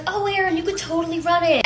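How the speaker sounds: mock encouragement. Runner imitating family urging her on, high mock encouragement. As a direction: gush it high, mimicking well-meaning family.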